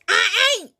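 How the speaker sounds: put-on high frightened boy; squeaky defiant denial; high panicky no; scared refusal.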